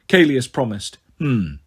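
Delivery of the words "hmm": low, thoughtful hum; king weighing it over; musing. A narrator's reading. King considering his servant's assurance, musing. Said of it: "Tarquin mused".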